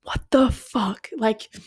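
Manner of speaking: slow, drawn-out whispered disbelief; appalled whisper. Real, not acted. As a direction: whisper each word, aghast. Appalled by the teacher's photo.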